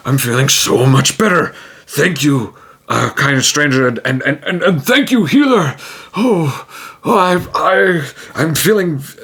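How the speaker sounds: an orc overjoyed to be cured; relieved orc. Acted. Orc healed, thanks the healer.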